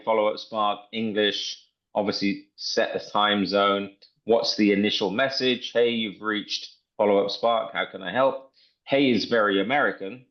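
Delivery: ordinary talk, nothing marked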